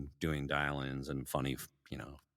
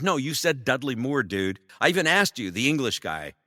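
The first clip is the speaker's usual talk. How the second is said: loud, raised-pitch retold protest; indignant; 8 semitones higher, 8 dB louder than usual. Performer blaming boss for mix-up, loud indignant retort. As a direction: argue back loudly in mock indignation. Arguing back about the mix-up.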